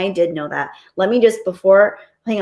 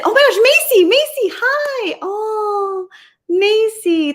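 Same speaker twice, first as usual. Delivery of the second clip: high, squealing happy hello; gushing, thrilled high voice; delighted greeting; 3 dB louder, slower than usual. Real, not acted. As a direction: greet a friend with real, high-pitched delight. Delighted greeting of a chat regular.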